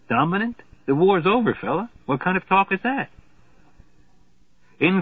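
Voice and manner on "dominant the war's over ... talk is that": lightly voiced colonel, pitched up; a hint of a scornful American colonel. Colonel to a German, mildly scornful. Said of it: "says the colonel".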